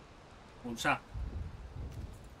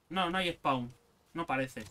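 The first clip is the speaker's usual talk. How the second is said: usual speaking voice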